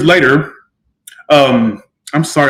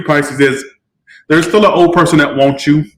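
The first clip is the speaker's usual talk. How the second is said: ordinary talk, nothing marked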